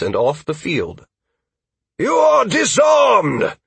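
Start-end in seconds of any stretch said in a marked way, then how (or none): 2.0-3.6 high, loud, drawn-out shout; referee's slow, commanding shout; shouted call. Acted. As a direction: bellow the verdict across the field. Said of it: "The Bond shout halted Caleb's".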